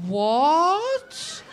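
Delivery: high, loud stunned what; imitating Milan's loud shocked what. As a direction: play the baffled victim, loud and high. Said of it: "And he just goes".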